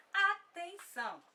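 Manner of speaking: slow, loud, high-pitched call; calling for attention. Real, not acted. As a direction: draw it out high and loud to grab the class. Professora chama a atenção da turma. (chamando a atenção, alto e pausado)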